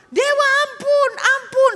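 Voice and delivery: high, loud wailing for mercy; terrified pleading. Acted. Kneeling tribe begs the false god for mercy, wailing. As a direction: beg for your life, shrieking.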